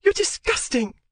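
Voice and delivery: very high, loud disgusted cry. Acted. Wife crying out at husband, disgusted. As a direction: fling the insult up high. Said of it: "the female cried".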